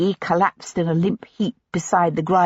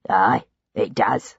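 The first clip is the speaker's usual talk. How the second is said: low, mocking villain voice; sneering captor; 9 semitones lower, slower than usual. A narrator's reading. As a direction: taunt her, amused and cold. Sullivan answers.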